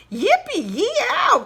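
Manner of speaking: high, loud whooping cry; cowboy whoop. Acted. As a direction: whoop for joy at perfect boots. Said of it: "cried the cowboy".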